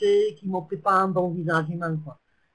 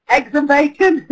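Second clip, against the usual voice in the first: excited; 5 semitones higher, 6 dB louder than usual. Real, not acted. Reads winning chat answer.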